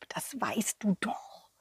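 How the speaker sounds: whispered Santa aside; whispering. Acted. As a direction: whisper it secretly.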